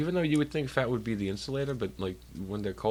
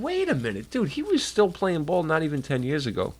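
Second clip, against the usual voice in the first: disbelief; 6 semitones higher, 4 dB louder than usual. Retold or imitated. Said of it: "I said".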